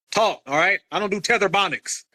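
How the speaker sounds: ordinary talk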